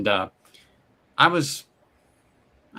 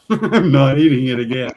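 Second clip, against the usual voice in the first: I'm not eating it again said louder through a laugh; laughing repeat; 6 dB louder than usual. Real, not acted. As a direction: relish the punchline while laughing.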